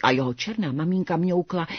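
ordinary delivery, nothing marked

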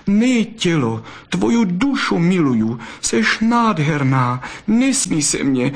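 court her with all your heart; lovesick fox. Acted. Fox declares his love to the vixen, high and ardent.

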